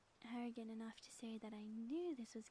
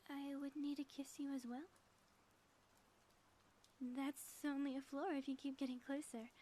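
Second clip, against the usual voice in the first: mock villain showdown; 3 semitones higher than usual. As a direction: play the dramatic villain lightly and teasingly. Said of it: "that was a good show".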